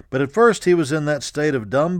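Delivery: ordinary delivery, nothing marked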